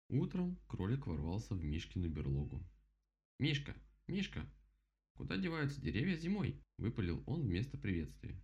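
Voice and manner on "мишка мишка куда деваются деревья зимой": high eager rabbit voice; excited rabbit. Acted. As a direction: blurt it out like a curious bunny. Rabbit character voice.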